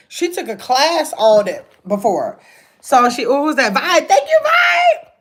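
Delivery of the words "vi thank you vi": excited thanks. Real, not acted. Thanking a viewer in chat.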